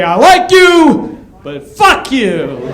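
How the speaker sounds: sweet then spiteful; passive aggressive. Retold or imitated. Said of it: "as if to say".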